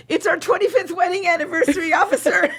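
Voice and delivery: high, sugary excuse to an officer; hammed-up high voice, ending in laughter; retold innocent plea.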